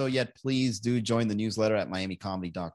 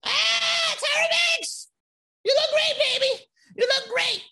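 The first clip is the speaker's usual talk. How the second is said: much higher, loud squealing fan shriek; mock starstruck gushing; 5 dB louder, slower than usual. Comedian meeting Tyra Banks, squealing starstruck fangirl shriek. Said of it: "I'm like".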